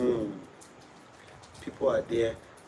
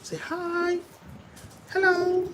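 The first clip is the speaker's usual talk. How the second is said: put-on baby-talk high pitch; slower than usual.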